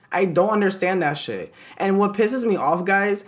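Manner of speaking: usual speaking voice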